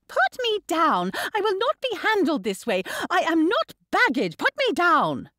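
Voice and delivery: put-on high-pitched, outraged young girl; indignant protest. A narrator's reading. Girl carried in against her will, indignant protest. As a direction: protest furiously at being manhandled.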